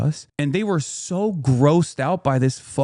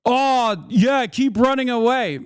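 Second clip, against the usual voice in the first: high-pitched taunt, imitated; mock yell; 8 semitones higher, slower than usual. Heckler calling after them at the gate, mock yell. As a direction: mimic a jeer called after someone. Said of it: "to yell from afar".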